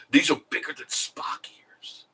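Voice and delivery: voiceless whispered aside; a whispered joke to the viewers. Real, not acted. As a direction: hush it like a secret joke. Aside about elf ears.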